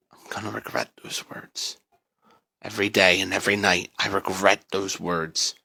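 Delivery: low, soft, regretful; quiet dread; haunted hush. A narrator's reading. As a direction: murmur it like a confession. Narrator recalls the horror.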